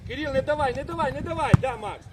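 loud, insistent sideline call; urgent shouting. Real, not acted. Coach urging Кирил to defend, shouting. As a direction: shout the warning at full voice.

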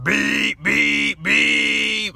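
imitated horn honks; ship horn. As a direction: honk it out like a ship's horn.

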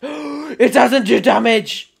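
gasp, then slow loud amazed exclamation; gasping discovery. Real, not acted. Player discovering fall trick, gasping in amazement. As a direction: marvel at the discovery.

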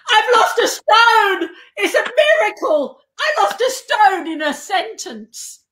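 loud, high, stretched-out jubilation; hammed-up cry of a miracle; overjoyed retelling. Guest learning the scales were wrong, mock-ecstatic. Acted joy at corrected scales.

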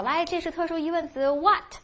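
high, mock-typical teacher voice. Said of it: "像一般老师可能会说".

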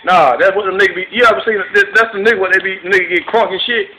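ordinary talk, nothing marked

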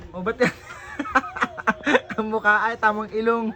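laughing between the words; can't keep from laughing at that face; laughing teasing. Real, not acted. Vlogger laughs at the crying child's face, amused teasing.

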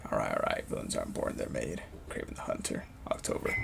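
very soft, low murmur; reading to himself. Real, not acted. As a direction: read the title card under your breath. Reads closing title to himself.

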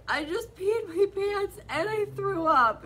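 high, loud, slow mock wail; hammed-up tearful whine; a wife wailing to her husband, hammed-up. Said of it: "And I was like".

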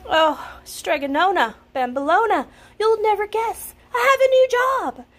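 high, loud, fast excited shout; a young man bursting with joy. A narrator's reading. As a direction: burst in with big news. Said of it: "Big Anthony shouted".

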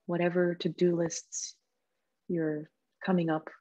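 soft, calm meditation voice with long pauses; guided meditation. Real, not acted. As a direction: gently guide them into stillness, leaving space between phrases.

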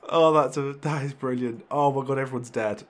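real excitement; excited. Real, not acted. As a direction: still buzzing from the win. Celebrating the win.

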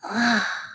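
audible breathy sigh; deep breath. Acted. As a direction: let out one deep breath. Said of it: "with a deep breath".

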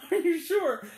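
imitating the character in a strange voice; mock questioning. Retelling scene, mocking the man.

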